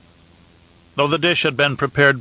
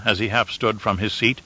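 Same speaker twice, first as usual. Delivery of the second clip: ordinary delivery, nothing marked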